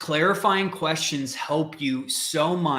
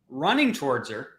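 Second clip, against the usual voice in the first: normal talking voice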